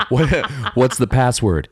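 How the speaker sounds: ordinary delivery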